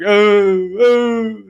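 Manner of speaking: high, drawn-out mock wail; imitated crying. Dramatic child crying in the closet, mock wailing. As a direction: wail it high, mimicking a crybaby kid. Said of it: "crying 'cause I was like".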